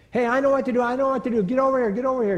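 put-on high, loud boy calling the others over; boy rallying the team to pray, loud and high. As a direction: shout to gather the boys in. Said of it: "you know, like this".

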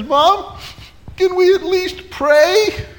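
high, loud, drawn-out tearful plea; mock crying child. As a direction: plead like a wailing little boy. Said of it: "as he's crying".

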